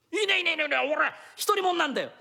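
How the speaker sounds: high, loud, rapid insistence; eager denial. Acted. Thief eagerly tells the mistress he's single, loud and high. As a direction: rush to prove he's single.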